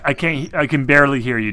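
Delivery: ordinary delivery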